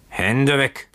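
sudden high warning cry; bellowed warning. A narrator's reading. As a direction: bellow it as a drunken boatswain warding someone off. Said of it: "brüllte der Bootsmann ihn an".

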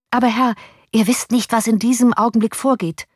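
a keen servant bursting with news; eager servant. A narrator's reading.

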